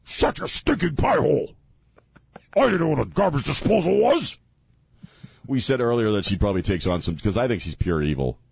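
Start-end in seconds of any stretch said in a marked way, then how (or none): 0.0-4.4 high bickering voice. Acted. Acting the couple.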